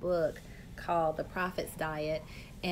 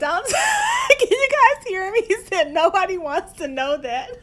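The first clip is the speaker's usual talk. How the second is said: laughing; 12 semitones higher, 13 dB louder than usual. Real, not acted. Laughing retelling husband's quip.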